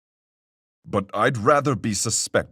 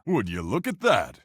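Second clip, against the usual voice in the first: ordinary talk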